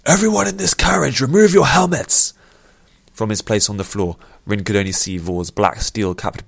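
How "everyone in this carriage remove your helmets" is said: high, loud shouted order; general's full-voiced bellow; booming command. A narrator's reading. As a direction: boom it out to the whole carriage. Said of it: "his voice boomed out".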